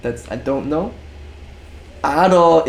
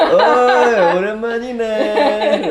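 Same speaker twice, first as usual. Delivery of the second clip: higher, louder mock menace, laughing; loan shark; 6 semitones higher, 5 dB louder than usual. Shown as a demonstration. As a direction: greet like a threatening loan shark. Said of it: "you're like".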